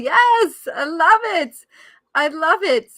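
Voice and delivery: loud, high, delighted yelling; excited cheering. Real, not acted. Coach cheering viewers on, loud and delighted. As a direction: cheer everyone on. Cheering after her demo sound.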